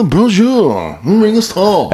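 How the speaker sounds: high, drawn-out mock French; mocking French accent with a Liverpool twist. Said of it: "You sound like [name] doing it".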